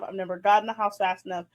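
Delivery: ordinary delivery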